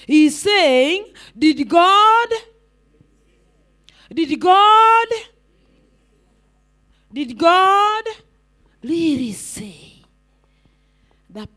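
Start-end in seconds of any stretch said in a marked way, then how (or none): 1.4-10.0 GOD held long and loud, then a slow "really say"; serpent's drawn-out question. Acted. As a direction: stretch "God" loudly each time, pause, then ease into "really say". Acting the crafty serpent's question, drawn out.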